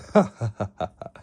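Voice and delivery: mock villain's laughter; acted villain laugh. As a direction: laugh as the amused evil boss. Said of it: "All for One started laughing".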